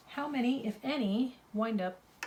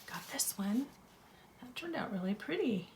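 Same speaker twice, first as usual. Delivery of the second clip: whispering; 7 dB quieter than usual. Real, not acted. Quiet aside while sorting pieces.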